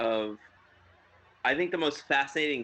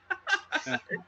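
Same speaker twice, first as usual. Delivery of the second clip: high laugh. Real, not acted.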